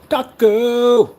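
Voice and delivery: loud high call of the name; playful loud call. Real, not acted. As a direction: shout the cat's name high. Calling the cat's name.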